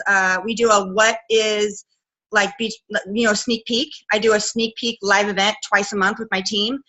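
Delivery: usual speaking voice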